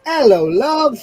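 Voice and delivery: a light British "Hello, love"; British greeting. Retold or imitated. Asked about British slang, tries a British accent. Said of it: "Do you say love".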